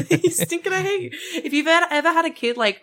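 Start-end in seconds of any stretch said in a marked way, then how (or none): 0.1-1.1 higher, giggly put-on insult; laughing mock insult. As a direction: sneer it through laughter.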